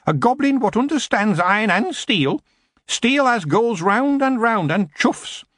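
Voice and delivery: cheeky goblin. A narrator's reading. Goblin prisoner cheeking the queen, cocky goblin voice. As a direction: grin while you talk back.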